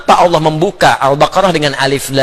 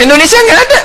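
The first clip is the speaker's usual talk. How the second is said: excited; 4 dB louder, faster than usual. Real, not acted. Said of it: "excited exclaim".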